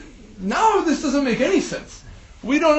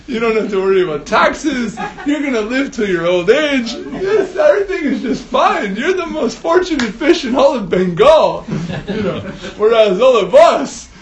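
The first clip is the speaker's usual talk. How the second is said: louder and higher, half laughing, half crying; envious fish blurting to its neighbour, loud and laughing; excited; 4 semitones higher, 5 dB louder than usual. Acted. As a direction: gush with laughing envy. Rui fish's tearful, laughing outburst.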